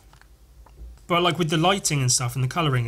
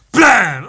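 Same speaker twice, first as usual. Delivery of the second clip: loud, higher burst imitating a gun; shouted gunshot noise; 6 semitones higher, 12 dB louder than usual. Voicing a comic-book gunshot, explosive shout. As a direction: fire the word out like a gunshot.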